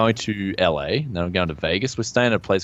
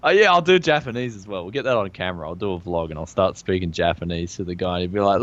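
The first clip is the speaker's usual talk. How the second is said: usual speaking voice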